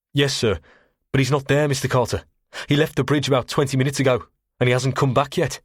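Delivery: put-on anxious young junior officer reporting. Young officer voiced in narration.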